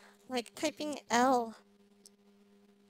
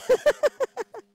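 burst of laughter; 9 semitones higher, 14 dB louder than usual. Real, not acted. Can't remember the song.

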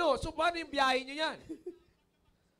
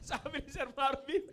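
normal talking voice

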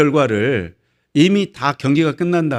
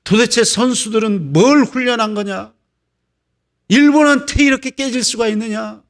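acted indignant rant climbing in pitch; mock-outraged soccer fan; 4 semitones higher than usual. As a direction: rail at coach and players, building to a higher pitch. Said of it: "난리를 칩니다".

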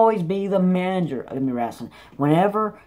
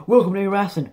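imitating Xavier; 4 semitones higher than usual. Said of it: "you're gonna have".

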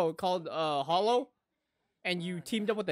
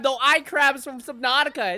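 usual speaking voice

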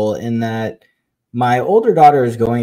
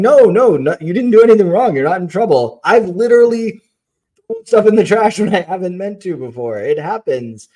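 reassuring; 7 semitones higher, faster than usual. Retold or imitated. Parents reassuring crying daughter.

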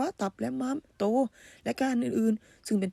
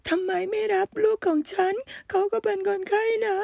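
desperate plea; 7 semitones higher than usual. Acted. Mother begging for her son.

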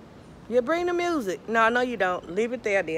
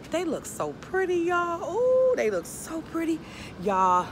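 high-pitched gushing; gushing admiration; 10 semitones higher, slower than usual. Real, not acted. Vlogger admiring the avocado and mango trees, gushing. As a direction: coo high over how pretty it is.

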